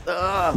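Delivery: high startled "ah" imitating the character; a quick panicked cry, lightly done; stressed yelp. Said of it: "he goes all, like, gets all stressed".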